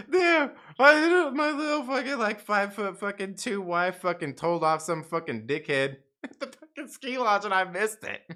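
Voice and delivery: high-pitched, talking through laughter; laughing. Retold or imitated. Husband amazed his wife told someone off, laughing. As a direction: crack up while retelling it. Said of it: "I was, like, laughing".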